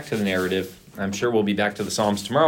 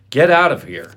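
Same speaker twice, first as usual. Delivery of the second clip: loud, higher-pitched angry dismissal; snarled order; 5 semitones higher, 7 dB louder than usual. A narrator's reading. Amnon throwing out his sister, snarled. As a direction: snarl it as a storyteller would. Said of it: "he snarled at her".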